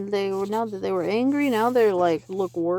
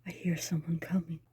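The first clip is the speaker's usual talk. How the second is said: soft unvoiced whisper; boy whispering a warning; 4 semitones lower, 8 dB quieter than usual. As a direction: whisper a warning so no one hears. Hiding from approaching sound.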